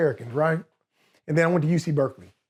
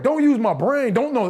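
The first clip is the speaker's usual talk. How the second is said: higher-pitched, baffled repeat; incredulous echo; 7 semitones higher, 3 dB louder than usual. Retold or imitated. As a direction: throw the order back in raised disbelief.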